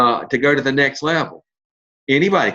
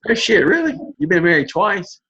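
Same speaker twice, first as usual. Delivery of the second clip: shocked; 6 semitones higher than usual. Retold or imitated. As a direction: act genuinely floored by the news. Retelling his shocked reaction.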